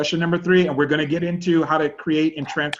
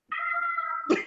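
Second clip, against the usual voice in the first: high squealing laugh; 8 dB quieter than usual. Real, not acted. Laughing at his own story.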